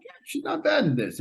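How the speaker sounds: high-pitched mock thought; inner thought. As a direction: squeak out a casual verdict. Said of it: "and I'm like".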